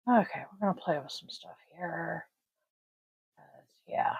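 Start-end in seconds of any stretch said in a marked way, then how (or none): 3.4-4.2 breathy unvoiced mutter; whispered aside. Real, not acted. Streamer adjusting colours, whispered aside. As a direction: whisper it to yourself.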